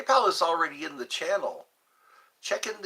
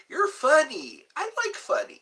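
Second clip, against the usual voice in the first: squealed high and loud; a delighted squeaky high praise; 13 semitones higher, 4 dB louder than usual. Pretend. Excited squeal at chat.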